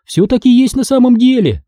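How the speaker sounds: usual speaking voice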